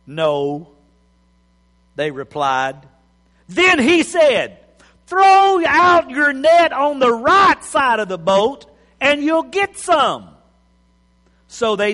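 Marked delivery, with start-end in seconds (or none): 0.0-0.7 a low, glum no; unhappy no. Acted. Disciples answering Jesus with a glum, low no. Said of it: "It wasn't a happy no". 5.1-10.3 calling out. Acted. Jesus calls from the beach.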